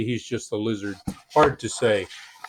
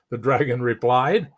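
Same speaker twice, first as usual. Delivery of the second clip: ordinary delivery, nothing marked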